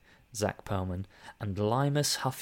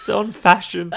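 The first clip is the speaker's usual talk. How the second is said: laughing; 10 semitones higher, 8 dB louder than usual. Real, not acted. Laughing at a typo.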